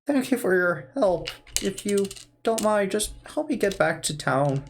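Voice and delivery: lightly voiced old man; a hint of a grateful old man. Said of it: "this is my default old man voice".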